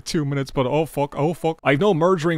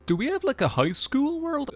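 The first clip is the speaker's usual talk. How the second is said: much higher, squeaky question; voice goes very high; 13 semitones higher than usual. Real, not acted. Gamer's voice cracks high asking about school. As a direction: let it jump up high. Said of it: "why did my voice get very high there".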